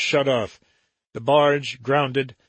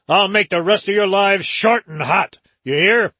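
loud, higher, harsh threat; savage threat; 6 semitones higher, 6 dB louder than usual. Acted. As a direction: threaten them with a snarl. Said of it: "Joram said savagely".

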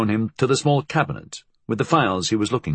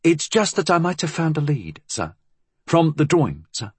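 higher-pitched, apologetic subordinate's voice; deferential inspector; 7 semitones higher than usual. Acted. Inspector offering a lead to his superior, deferential.

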